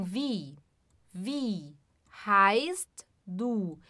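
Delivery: wie with a long drawn-out I sound; teacher showing the long I in wie; long vowel. Shown as a demonstration. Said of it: "a gente faz o som do I mais longo".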